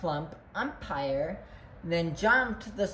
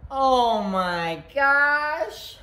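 loud, higher, very drawn-out exclamation; hammed-up storybook alarm; exclaimed alarm; 4 semitones higher, 6 dB louder, much slower than usual. A narrator's reading. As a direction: stretch every word out big and loud. Recurring exclamation in read-aloud.

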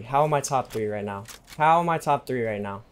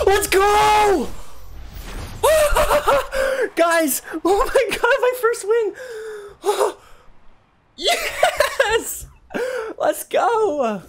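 very high, screaming with laughter and sobs; ecstatic screaming; 3 dB louder, much slower than usual. Real, not acted. Streamer celebrating his first solo win, ecstatic screaming. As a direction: scream with real joy, half laughing half crying. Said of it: "I'm freaking so excited".

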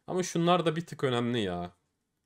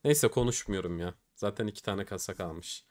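ordinary talk

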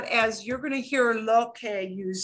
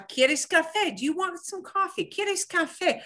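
higher-pitched sample offer of coffee; acted offer; 9 semitones higher than usual. Shown as a demonstration. Teacher acting out a coffee offer for student, lightly acted offering. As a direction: play someone offering a drink, pitched up.

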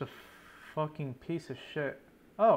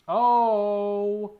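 alarmed oh; 6 semitones higher, 10 dB louder than usual. Real, not acted. Sees dangerous mob spawn.

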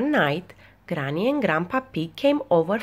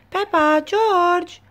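high, loud call with drawn-out names; 13 semitones higher, 6 dB louder, much slower than usual. A narrator's reading. Daddy Pig calls Peppa and George to bath, calling out. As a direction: call the children in, pitched high. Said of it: "called Daddy Pig".

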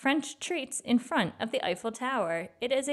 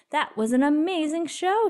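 excited; 6 semitones higher, 6 dB louder than usual. Acted. Pete thrilled after wild concert.

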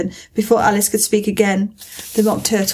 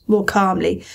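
usual speaking voice

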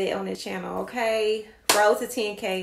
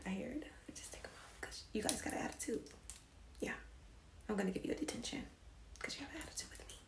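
whispered mock scolding; 20 dB quieter, faster than usual.

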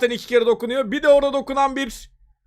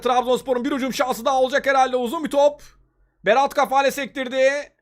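usual speaking voice

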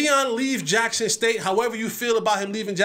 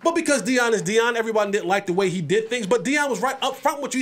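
usual speaking voice

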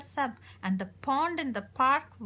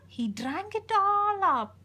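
higher, louder and slow, building to the end; excited; 8 semitones higher, 6 dB louder than usual. A narrator's reading. Reader marvelling to a child, excited. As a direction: marvel at it all. Climax of river verse.

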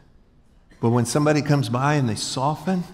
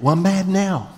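higher and louder, hammed-up annoyance with a laugh; mock huffy retort; 6 semitones higher, 3 dB louder than usual. Pastor replays arguing with wife, hammed-up anger. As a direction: play up being mad now, laughing. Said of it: "I go".

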